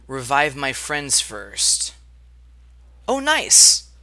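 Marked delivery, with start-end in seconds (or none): 3.1-3.9 excited. Real, not acted. Sudden win in game.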